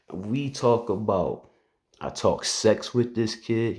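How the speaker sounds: ordinary delivery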